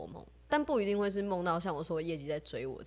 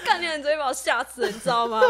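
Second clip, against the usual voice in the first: laughing mock outburst, louder; 9 semitones higher, 9 dB louder than usual. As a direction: quote the scared friend while cracking up. Retelling roommate's scared outburst.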